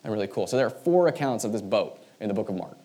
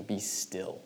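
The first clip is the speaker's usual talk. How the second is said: hushed; 3 semitones lower, 8 dB quieter than usual. Shown as a demonstration. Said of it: "very specific in their culture".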